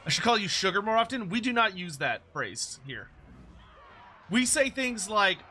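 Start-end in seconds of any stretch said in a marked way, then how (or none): none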